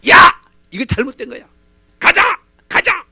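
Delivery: loud, high furious commands; angry shouting. Retold or imitated. Naaman shouting at his servants in anger. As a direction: bark orders to leave.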